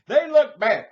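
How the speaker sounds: imitating the customer's loud shrill complaint; shrieking complaint. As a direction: screech it like an outraged customer. Customer yelling.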